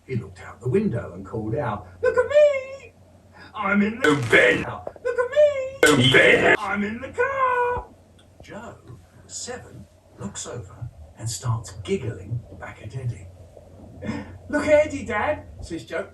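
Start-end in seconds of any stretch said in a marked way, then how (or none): 2.0-7.9 put-on very high, loud little-boy shout; toddler voice. Little boy calling to his dad from the car, high and loud. 14.5-15.4 put-on a little higher, child telling on his brother; lightly voiced child. Older brother pointing out the toddler to his dad, lightly voiced.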